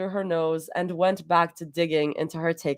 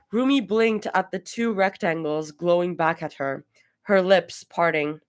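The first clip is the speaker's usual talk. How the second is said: ordinary delivery, nothing marked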